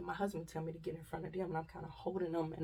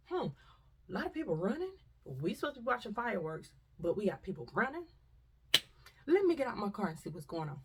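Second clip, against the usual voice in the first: mock cop; 4 semitones higher, 5 dB louder than usual. Mocking the police in cars.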